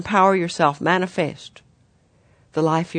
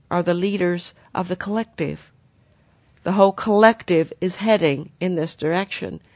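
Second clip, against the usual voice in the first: ordinary delivery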